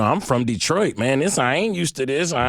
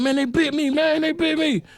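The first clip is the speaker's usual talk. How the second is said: high, wailing mock alarm; panicked cousin imitation. Cousin back from the brawl with bite marks, high mock panic. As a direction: imitate a panicked man, pitched way up. Retelling frightened bitten cousin.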